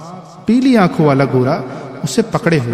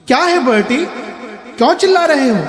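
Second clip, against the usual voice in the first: high call from another room; high raised call; 10 semitones higher, faster than usual. A narrator's reading. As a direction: shout through the bathroom door. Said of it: "किसी ने चिल्ला कर कहा".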